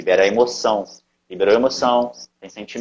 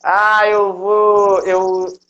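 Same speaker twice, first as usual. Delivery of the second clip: slow, higher, louder declaring voice; mock affirmation; 7 semitones higher, 4 dB louder than usual. Declaring a weight-loss affirmation aloud in a higher, louder voice. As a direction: declare it like a proud mantra. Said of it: "por exemplo".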